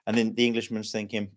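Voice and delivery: normal talking voice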